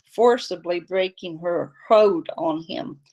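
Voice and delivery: ordinary delivery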